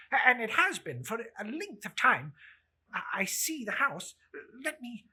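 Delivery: anxious Scrooge. Acted. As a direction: press the spirit, hurried and uneasy. Scrooge begs at his office.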